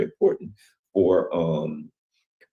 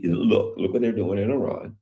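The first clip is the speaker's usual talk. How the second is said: normal talking voice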